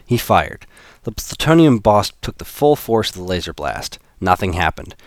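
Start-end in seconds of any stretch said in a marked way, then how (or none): none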